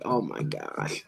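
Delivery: usual speaking voice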